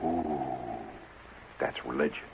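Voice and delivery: low, soft reverent murmur; a hushed awed crowd. Shown as a demonstration. The golf crowd reacting to the miss, hushed and awed. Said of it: "And then the crowd goes".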